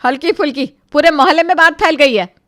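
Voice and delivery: loud, high scornful echo; angry retort. Acted. Mother-in-law scolds Rani, loud and scornful. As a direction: throw her words back at her.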